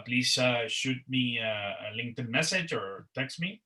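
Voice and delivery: ordinary talk, nothing marked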